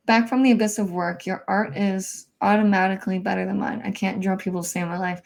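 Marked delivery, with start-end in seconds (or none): none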